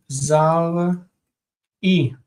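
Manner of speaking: "zar-i" said slowly, split as zar and i; slow root spelled out. Shown as a demonstration. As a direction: stretch out "zar-i" syllable by syllable. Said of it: "The root is".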